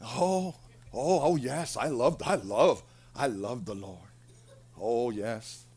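low, soft, feeble devotion; mock hospital patient. Sick person in hospital professing love for the Lord, mock hospital patient. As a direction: imitate a weak patient, fading into low sighs. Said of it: "they're laying in the hospital".

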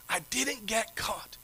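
slow, soft whisper of relief; a sneaky whispered echo; hushed whisper. Real, not acted. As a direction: whisper it like you're still hiding. Relieved echo after sneaking back.

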